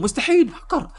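high-pitched, hammed-up shock; stunned villain squealing, drawn out at the end; shocked disbelief. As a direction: cry cheat in comic disbelief. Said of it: "زعيم الشياطين مصدوم".

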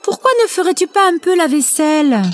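voix de poule aiguë et rapide; débite les consignes vite, haut perché; mère poule affairée. A narrator's reading. (poule de conte pressée, voix aiguë)

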